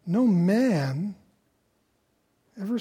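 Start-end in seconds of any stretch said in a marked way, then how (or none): 0.1-1.2 "man" drawn out with a pitch swing; emphasize "man" as the key word; stress on man. Shown as a demonstration. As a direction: land deliberately on "man". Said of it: "emphasis on the word man".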